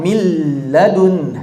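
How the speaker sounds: "Mil ladun" slow with a doubled l and no nasal; slow correct model of "Mil ladun"; merged lam demo. Shown as a demonstration. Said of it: "langsung seperti lam tasdid biasa".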